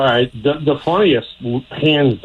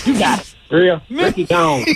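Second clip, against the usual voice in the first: Ricky voice; 9 semitones higher than usual. Retold or imitated. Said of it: "He goes".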